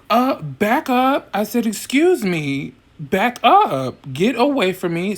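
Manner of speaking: slow, high warning to back off; firm back off. Retold or imitated. Woman at a bar telling a loud man to back off, slow and firm. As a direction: draw it out, stern and pointed.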